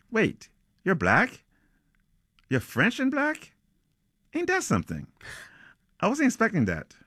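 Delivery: acted surprise, pitched well up; astonished. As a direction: react in a high voice to an unexpected stranger. Roommate astonished by the newcomer.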